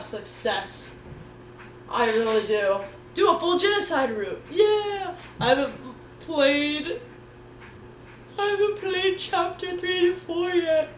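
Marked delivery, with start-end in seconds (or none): 3.1-5.1 squeaky chat voice. Acted. 5.4-10.9 a high, squeaky cartoon voice; high squeaky put-on voice. Squeaky voice for chat reply.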